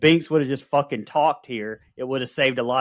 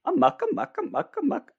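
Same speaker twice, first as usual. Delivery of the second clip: much higher, shrill-free witchy squeal of amuck; Winifred impression. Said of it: "lines from this movie my wife constantly says".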